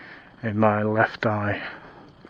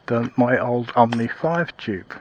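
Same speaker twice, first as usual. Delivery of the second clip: normal talking voice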